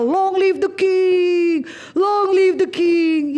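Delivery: mock crowd chant, stretched out; carnival cheer. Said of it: "Kayak kita teriak karnaval".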